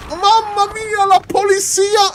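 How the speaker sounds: high, hammed-up Italian outburst; mock Italian exclamation. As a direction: throw up your hands, Italian style.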